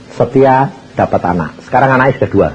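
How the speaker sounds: normal talking voice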